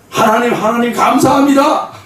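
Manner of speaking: high-pitched, exuberant cry of gratitude; elated thanks. Acted. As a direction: cry out joyful thanks to God, hammed up. Acted grateful cry to God. (감격에 찬 높은 목소리)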